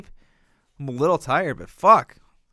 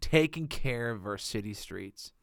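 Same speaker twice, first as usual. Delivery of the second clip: low, soft, unbothered shrug; mock resigned; 4 semitones lower, 7 dB quieter than usual. Man retelling waking to street plows, softly resigned. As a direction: imitate drowsy acceptance, quiet and low.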